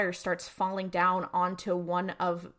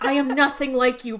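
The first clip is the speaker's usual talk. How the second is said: mock dramatic quote; 5 semitones higher, 9 dB louder than usual. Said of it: "And I'm like, "Girl".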